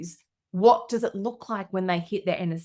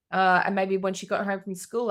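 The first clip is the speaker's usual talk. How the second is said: normal talking voice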